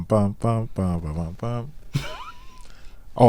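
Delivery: ordinary delivery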